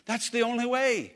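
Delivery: lifted pulpit delivery; preaching. Acted. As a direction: preach it out to the congregation. Preacher driving home the message.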